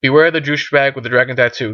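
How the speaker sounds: ordinary talk, nothing marked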